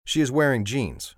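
usual speaking voice

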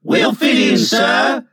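put-on loud, high chorus of boys; eager assurance. A narrator's reading. Boys assuring Mr Darling, eager shout. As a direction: call it out together, high and loud. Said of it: "they assured him".

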